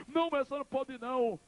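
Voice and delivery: high crying refusal; acted anguished protest. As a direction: burst in with a high, pleading no. Son refuses mother's farewell.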